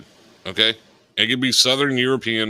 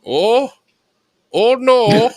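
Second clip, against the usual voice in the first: much higher and louder oh no; high mock panic; 11 semitones higher, 4 dB louder, much slower than usual. Agent panicking on the call, high mock voice. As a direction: squeal a fake panicked oh no.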